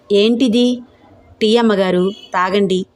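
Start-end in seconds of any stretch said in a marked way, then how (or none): none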